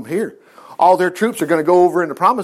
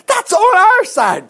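high, loud and slow triumphant cry; triumphant exclaim; 12 semitones higher, 5 dB louder, slower than usual. Real, not acted. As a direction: shout the victory out slowly. Chariots of fire climax.

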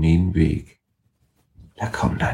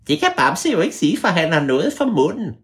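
higher, lightly voiced teddy bear; 10 semitones higher than usual. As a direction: play Bamse, a little higher.

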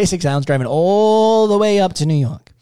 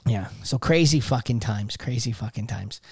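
quiet; 7 semitones lower, 8 dB quieter than usual. Real, not acted. Wistful reflection after story.

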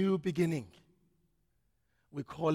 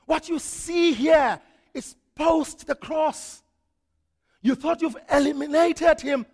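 higher, louder shouting defiance; Peter's defiance; 12 semitones higher, 13 dB louder than usual. Retold or imitated. Peter shouting down the religious authorities, defiant and loud. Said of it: "Peter was saying to them".

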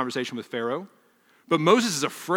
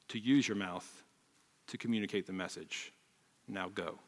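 low, soft, firm rebuke; quiet weight behind each word; sterner God; 3 semitones lower, 6 dB quieter than usual. Retold or imitated. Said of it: "The tone changes".

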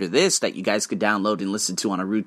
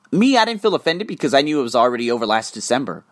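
ordinary delivery

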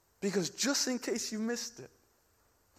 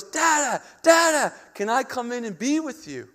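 high, loud imitation of an eager child; little daughter calling; 6 semitones higher, 6 dB louder than usual. Small daughter at the glass door calling dad, eager imitation. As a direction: call out like a small kid, high-pitched. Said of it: "and she goes".

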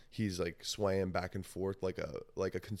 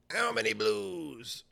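high, slow mimicked holler; addled dealer; 13 semitones higher, 3 dB louder, slower than usual. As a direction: stretch it out high, spaced-out. Imitating addled dealer counting pills.

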